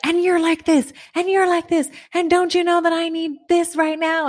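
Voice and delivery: high, rushed, exasperated mom rant; mother ranting at her kids, high and exasperated; word vomit rant. Retold or imitated. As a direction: spill it all out like a frazzled mother. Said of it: "word vomit".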